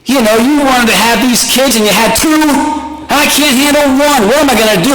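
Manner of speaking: high-pitched panicked rant at his wife; hammed-up new-dad panic; freaking out. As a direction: voice up high, retelling the panic half-laughing. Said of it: "I was freaking out".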